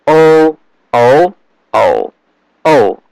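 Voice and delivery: O level, rising, dipping, then falling; four-tone drill. Shown as a demonstration. Teacher reading the tone table, four tones on O. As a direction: recite each O with the next Mandarin tone. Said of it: "tone tables for these six vowels".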